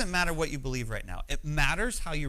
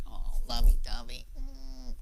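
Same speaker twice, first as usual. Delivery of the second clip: mock lovey-dovey; 4 semitones lower, slower than usual. Pastor mocking a wedding favorite passage, lovey-dovey put-on cooing. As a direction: coo it in imitation of gushy romance. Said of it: "we've turned it into this".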